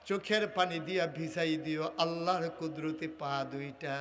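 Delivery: low, melodic call to prayer; subdued chanted exhortation; tender preaching. Real, not acted. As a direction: intone it low, as if pleading with God.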